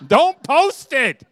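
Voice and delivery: high, loud, drawn-out plea; playful shout. Real, not acted. Speaker scolds moms about showing off healthy cooking, playful shout. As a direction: belt it out in mock exasperation.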